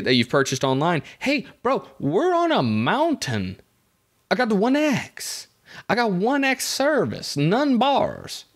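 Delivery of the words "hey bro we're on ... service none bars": high, exasperated complaint; whiny, put-upon protest. Retold or imitated. Complaining to ticket booth, high.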